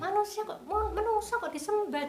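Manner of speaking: indignant questioning. Retold or imitated. Mother questioning her daughter, high and indignant. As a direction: plead in high outrage.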